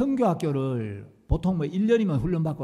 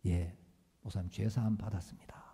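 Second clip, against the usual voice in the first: timid whisper; 9 semitones lower, 9 dB quieter than usual. Retold or imitated. Timid student answering the pastor.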